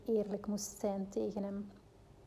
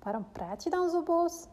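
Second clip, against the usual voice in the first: higher, lightly voiced puzzled little boy; surprised; 8 semitones higher than usual. A narrator's reading. Son questions his mother's tone, surprised childlike voice. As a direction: ask it wide-eyed, as a small child. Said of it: "zei hij verbaasd".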